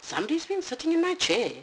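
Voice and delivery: Mama Bear complaining shrilly; shrill Mama Bear. Acted. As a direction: squeak it out quickly.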